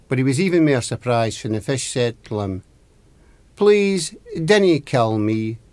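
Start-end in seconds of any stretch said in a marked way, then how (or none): 3.5-5.6 high, emphatic plea, dropping on the last words; mildly acted cry for mercy; pleading. Caught fish begs the fisherman for life, pleading.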